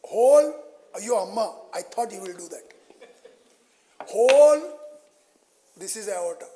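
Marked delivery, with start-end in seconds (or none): 0.0-0.5 loud exclamation. Real, not acted. Teacher exasperated at a wrong drawing. 4.1-4.6 loud exclamation. Real, not acted. Teacher exasperated at a wrong drawing.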